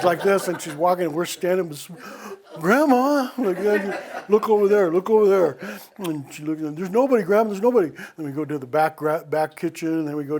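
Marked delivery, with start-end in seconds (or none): 2.6-5.6 put-on high, loud frightened child's cry; a kid pointing at the dark, shouting in fear; scared kid calling. Kids calling grandma in fear. 6.8-7.9 high, loud scared protest; a child pleading with grandma, loud and scared; frightened kid. Retold or imitated.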